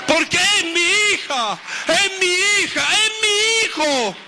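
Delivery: God's voice, proclaiming. Acted. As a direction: declare it as a loving Father calling out. Voicing God claiming his children.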